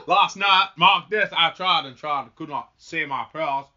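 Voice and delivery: loud, emphatic Southern drawl; Forrest Gump. Acted. Farmer confides failed prayers to minister, Southern drawl. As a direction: start forceful in a folksy Southern accent, easing off. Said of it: "I'm not trying to become Forrest Gump".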